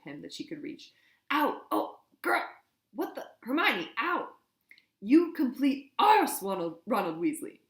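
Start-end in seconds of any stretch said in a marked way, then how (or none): 1.3-4.3 loud, high yelps of pain while being hit. A narrator's reading. Ron punched by Hermione. 5.0-7.6 furious, every word punched. A narrator's reading. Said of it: "She punched every word with a blow".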